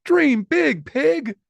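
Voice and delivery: very high, squeaky cheer; squeaky best friend. Acted. Best friend Emma cheering Poppy, very high and squeaky. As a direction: chirp it like an excited little friend. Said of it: "said Emma".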